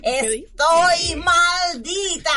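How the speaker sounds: very slow eerie ghost voice. Acted. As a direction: moan it out like a ghost. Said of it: "soy el fantasma".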